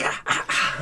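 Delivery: voiceless puffing "ah" sounds; imitated fanning effort. Man retelling fanning smoke with paper plate, breathy huffs. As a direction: huff in short repeated bursts.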